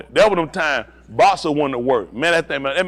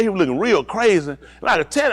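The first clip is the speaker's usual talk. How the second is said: ordinary talk, nothing marked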